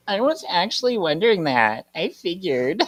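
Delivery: sassy. Acted. Piper chimes in on the argument, mildly sassy. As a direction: lift the pitch with a touch of sass. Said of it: "sassy Piper".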